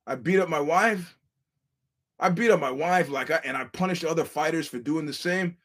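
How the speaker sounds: mock boss demanding sympathy; mock arrogant boss. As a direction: sneer at critics as the boss. Mimicking boss self-justifying.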